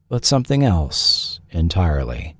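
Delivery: ordinary talk, nothing marked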